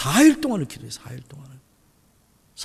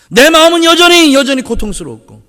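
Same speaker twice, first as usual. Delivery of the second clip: ordinary talk, nothing marked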